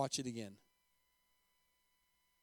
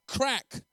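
sharp, higher voiced whip crack; belt crack; 8 semitones higher, 3 dB louder than usual. A narrator's reading. Preacher narrates the first blow, sharp crack. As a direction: imitate a belt striking hands.

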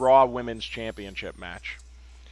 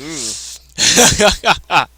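loud mocking ooh; 5 semitones higher, 14 dB louder than usual. Real, not acted. Reacting to a burn.

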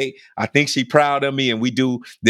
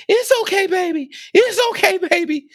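comforting crowd. Retold or imitated. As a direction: coo at a crying grown man. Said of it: "I hear people saying".

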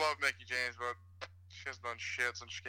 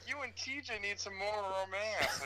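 high-pitched imitation of the mom; high mock mom voice; 6 semitones higher, 4 dB quieter than usual. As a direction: mimic an annoying mom, pitched up. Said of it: "her voice is brutal".

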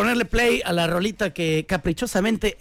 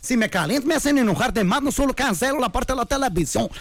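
mock Brazilian-accented Spanish, lively rant; hammed-up Brazilian coach's accent; Tuca; 3 semitones higher than usual. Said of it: "como dijo el Tuca".

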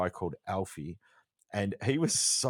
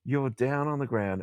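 excited; 7 semitones higher, 5 dB louder than usual. Real, not acted. Excited about frost at midday.

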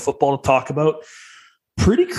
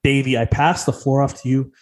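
usual speaking voice

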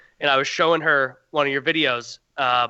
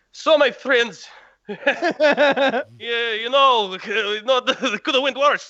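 higher, laughing imitation of a Russian accent; lightly mimicking a friend's Russian accent; mock Russian accent; 7 semitones higher, much slower than usual. Teasing the host about his old video, mock Russian accent. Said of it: "the Russian voice almost quivers".

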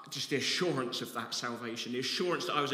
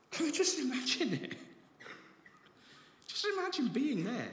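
laughing wonder; 12 semitones higher than usual. Real, not acted.

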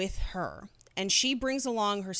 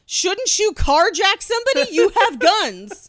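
incredulous mock question; 11 semitones higher, 11 dB louder than usual. Podcaster mocking armed fugitives on the bus, incredulous high and loud. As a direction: play it like you can't believe they'd ride the bus. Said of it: "And you're just like".

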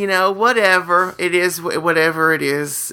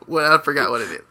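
usual speaking voice

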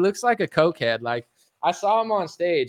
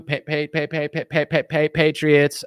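stumble; 2 semitones lower than usual. Real, not acted. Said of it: "I stumbled on the pay".

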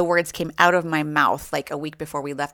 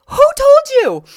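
much higher and louder; shocked outcry; 14 dB louder than usual. Retold or imitated. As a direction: blurt it out in disbelief. Mother reacting to news.

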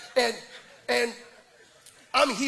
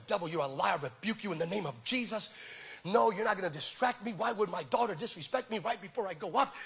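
rebuking the devil; 3 semitones lower, 7 dB quieter than usual. Retold or imitated. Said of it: "I started rebuking".

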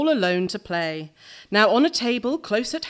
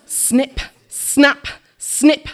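punchy scissor snips; 5 semitones higher, 5 dB louder, much slower than usual. A narrator's reading. Scissors sound in poem.

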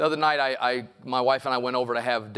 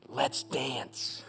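whispered in ear; 7 dB quieter than usual. Retold or imitated. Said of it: "says to me in my ear".

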